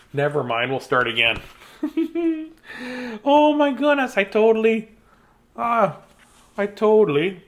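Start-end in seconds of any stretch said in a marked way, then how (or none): 1.8-7.4 flustered exclaim. Real, not acted. Embarrassed at missing page.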